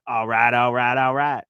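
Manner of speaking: drawled "all right, all right, all right" as McConaughey; lazy Texan McConaughey catchphrase; Matthew McConaughey impression. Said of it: "like a Matthew McConaughey impression".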